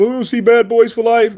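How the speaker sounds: lightly put-on high, eager voice; mimicked eager fan. People asking about the new Will movie, mimicked eagerly. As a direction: pitch it up like an excited moviegoer.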